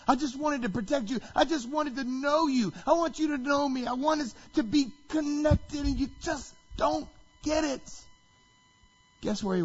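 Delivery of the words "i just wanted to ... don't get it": high, pleading lament; anguished plea. Retold or imitated. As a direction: plead with longing, acting Jesus. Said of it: "he weeps".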